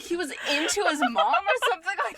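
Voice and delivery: high, loud, talking through laughter; laughing confusion. Real, not acted. Player admits misunderstanding the mom remark, real laughing disbelief. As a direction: blurt it out while cracking up.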